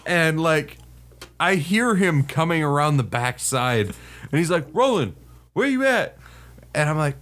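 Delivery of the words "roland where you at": imitated calling out. First sergeant coming round the back for Roland, imitated call. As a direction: call for someone out of sight, mock search.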